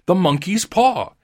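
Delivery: loud, high wild exclamation; wild cry. A narrator's reading. Wife waking her husband about the paw, loud wild cry. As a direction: burst out with it wildly. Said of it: "she cried wildly".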